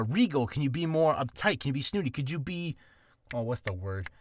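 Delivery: ordinary talk, nothing marked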